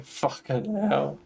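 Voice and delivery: ordinary talk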